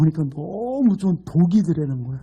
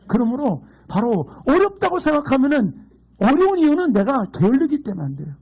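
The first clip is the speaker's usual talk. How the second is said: fervent preaching; 6 semitones higher than usual. Acted. Pastor exhorting the congregation, fervent preaching. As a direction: drive the point home to the flock.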